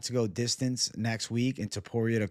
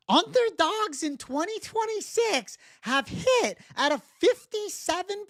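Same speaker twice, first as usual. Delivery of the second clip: Johnny the Greek impression. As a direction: squeak out the picks like an overexcited gambling pundit. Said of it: "impression of the Johnny the Greek".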